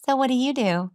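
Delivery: a hint of a shriveled, nervous dater, high; timid date question. Coach acts out a nervous dinner date, lightly. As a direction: mimic a contracted small-talker. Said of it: "you're like this".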